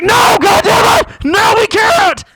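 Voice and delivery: high, loud, slow furious shout; angry heckler yell. Retold or imitated. Heckler in the crowd yelling at the comic, slow furious shout. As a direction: bellow it like an outraged audience member. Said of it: "someone else was like".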